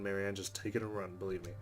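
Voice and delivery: ordinary talk, nothing marked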